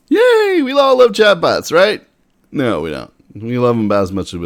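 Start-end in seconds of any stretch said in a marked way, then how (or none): 0.1-2.1 high, loud mock enthusiasm; a hammed-up yay for chatbots; sarcastic cheer. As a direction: fake delight, dripping with irony.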